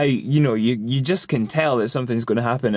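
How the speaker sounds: normal talking voice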